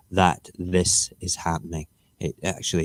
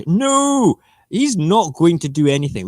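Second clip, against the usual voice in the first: scoffing retort; 9 semitones higher, 6 dB louder than usual. Acted. Answering the headline mockingly.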